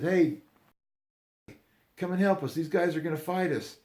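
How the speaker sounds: high, loud, urgent call to a friend; calling for help. Retold or imitated. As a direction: act out kids calling a buddy over.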